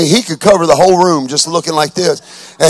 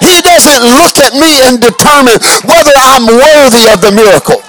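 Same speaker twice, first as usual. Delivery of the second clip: loud, higher, rhythmic sermon cadence; full-force preaching; 8 semitones higher, 7 dB louder than usual. Acted. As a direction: preach it out to the congregation.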